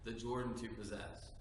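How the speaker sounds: low, measured lesson reading; scripture reading. Acted. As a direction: read it like the Old Testament lesson. Reads Deuteronomy lesson aloud.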